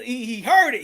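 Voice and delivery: usual speaking voice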